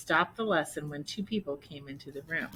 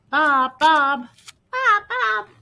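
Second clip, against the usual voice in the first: very high, loud calling; 12 semitones higher, 11 dB louder, slower than usual. Acted. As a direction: call the dog from afar. Owner calling dog.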